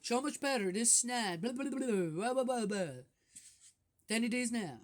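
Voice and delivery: mocking lament, blah blah blah. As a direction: parody an old-timer grumbling about the good old days. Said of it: "Why would I lament and say".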